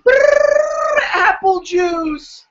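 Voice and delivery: high, slow silly voice; goofy voice. Pretend. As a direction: drag out apple juice in a cartoon voice. Goofy voice bit.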